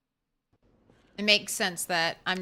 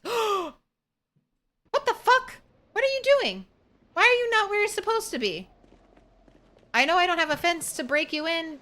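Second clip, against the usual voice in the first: shocked disbelief; 6 semitones higher than usual. Real, not acted. Streamer scolds escaped farm animals, high shocked disbelief. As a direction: squeal in disbelief at the runaway animals.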